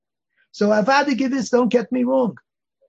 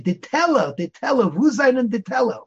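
insistent Yiddish question; 7 semitones higher, 4 dB louder than usual. Retold or imitated. Asking a Belzer boy for the grocery, insistent. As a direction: repeat it louder, pressing the child. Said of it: "So I say".

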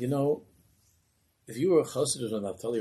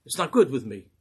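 louder, higher angry demand; screamed; 4 semitones higher, 8 dB louder than usual. Acted. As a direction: shout it at him in anger. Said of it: "the Rebbe screamed at him".

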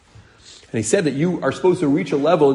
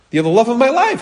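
doting newlywed; 11 semitones higher, 5 dB louder than usual. Acted. Acting doting husband answering wife.

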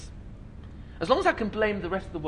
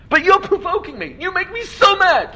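mock angry complaint; 11 semitones higher, 6 dB louder than usual. Acting an angry blamer.

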